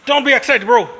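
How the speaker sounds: high, very loud command; real high-pitched yelling; urgent scolding shout. Real, not acted. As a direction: shout to calm an overexcited pet. Calling off an excited animal.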